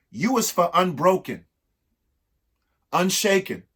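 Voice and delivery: rhythmic, lilting read-aloud of a rhyming book; reading to children. Acted. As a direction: read each verse to the kids with a bounce.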